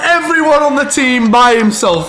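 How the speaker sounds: high, slow, disbelieving cry; amazed. Real, not acted. Co-commentator stunned by a solo goal, amazed. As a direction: marvel at the impossible run.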